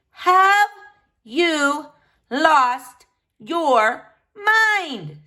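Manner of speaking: loud, very slow, drawn-out scolding; wife berating her husband, slow and loud; incredulous anger. Acted. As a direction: spell out each word in fury.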